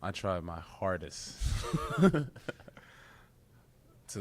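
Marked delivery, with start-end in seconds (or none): 1.4-2.8 laugh. Real, not acted. Laughing about next step.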